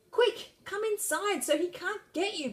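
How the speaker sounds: high, urgent warning shout. A narrator's reading. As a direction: call the grown-ups inside. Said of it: "Priscilla shouted".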